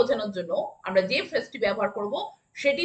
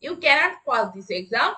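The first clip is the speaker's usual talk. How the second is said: very slow, word by word; slow classroom dictation; much slower than usual. Shown as a demonstration. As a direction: spell it out slowly for the class. Teacher repeats the sentence.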